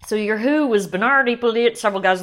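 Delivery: a light country accent creeping in. Real, not acted. Host listing producer names, light country accent. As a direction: slip into a hint of a country accent. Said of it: "random country accent".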